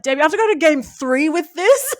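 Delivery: high, loud exasperated whine. Retold or imitated. Opponent whining at Debbie, high and loud. Stumpy whining about the matchup.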